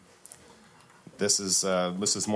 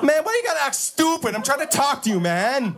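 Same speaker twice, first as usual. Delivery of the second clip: irritated cashier; 5 dB louder than usual. Acted. Cashier loses patience with poet.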